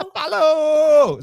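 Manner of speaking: high, stretched echoing yell; drawn-out name call. Acted. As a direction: echo the long chant, high and loud.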